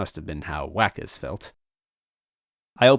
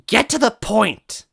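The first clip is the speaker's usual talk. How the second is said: high, slow, shouted demand; 7 dB louder, slower than usual. Acted. Tied-up Toadette yelling at the villain. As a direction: yell it at him, fed up. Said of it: "Toadette shouted".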